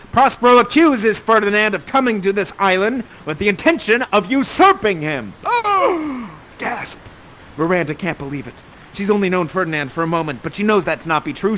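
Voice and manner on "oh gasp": high exclaimed gasp; mock shocked gasp. Narrator acting Miranda's shock at the accusation. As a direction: gasp in hammed-up horror.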